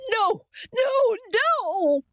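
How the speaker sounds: very high, frantic cried refusal; rising shriek. A narrator's reading. Ailing mother refusing to lie down, shrieking. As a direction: cry out shrieking in protest. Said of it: "her voice rising to a shriek by the end".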